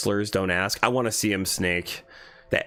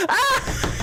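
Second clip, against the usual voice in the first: high burst of helpless cackling; shriek, then laugh uncontrollably at length; real shrieking laughter. Real, not acted. Bursts out at a story.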